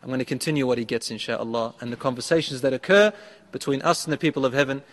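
normal talking voice

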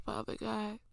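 prayer. Real, not acted. Leads a prayer.

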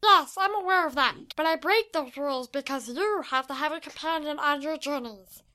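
loud, high creature voice, easing off; small creature's put-on voice; high-pitched little creature. A narrator's reading. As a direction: insist like a tiny squeaky creature. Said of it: "said in a high-pitched voice".

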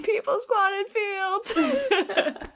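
squeezed out slowly through laughter; giggling mock line; laughing quote. Laughing retelling of a mocking quote.

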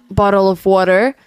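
American pronunciation of "bottle of water"; American English accent. Shown as a demonstration. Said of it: "before I came here, I used to say".

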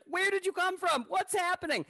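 very high, astonished questions; amazed. Retold or imitated. Host retelling his shock at the girl popping out, very high. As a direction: sound floored by the surprise. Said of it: "I was like".

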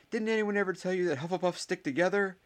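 high-pitched rallying yell; shout. A narrator's reading. Ernie rallies his house, high shouting. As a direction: build into a loud battle cry. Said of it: "shouted Ernie".

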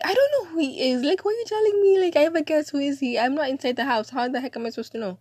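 loud, high-pitched indignant rant; worked-up retelling of a sibling spat; exasperated retort. Retold or imitated. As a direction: fire back questions, totally exasperated. Retold exasperated retort to brother.

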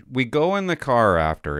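ordinary talk

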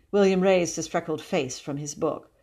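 plain reading voice

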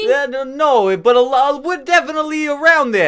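loud, high-pitched mock New York Italian; New York Italian accent. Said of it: "New York Italian".

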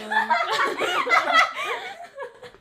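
breathless high-pitched giggle; helpless high laugh. Real, not acted. Giggling fit during intro.

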